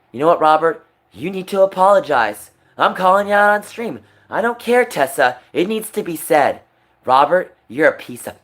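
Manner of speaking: real anger, raising the voice at a friend on stream; angry calling out. Real, not acted. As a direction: call Robert out with real anger. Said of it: "I'm sorry I'm angry and upset you".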